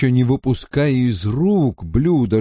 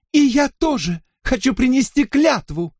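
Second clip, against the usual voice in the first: put-on high, eager girlish cry; excited exclamation; 13 semitones higher than usual. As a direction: burst in clapping with delight. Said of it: "воскликнула, хлопая в ладоши". (восторженный возглас)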